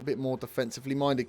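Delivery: normal talking voice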